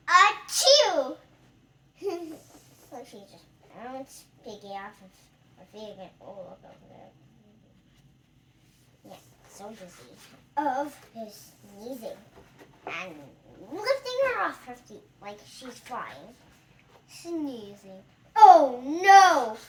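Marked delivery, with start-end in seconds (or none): 0.1-1.2 loud fake sneeze; big sneeze. 18.4-19.6 loud cry of alarm; loud dismay. Acted. Dismay in story.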